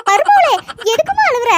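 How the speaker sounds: a touch of a fussing mother-in-law. Acted.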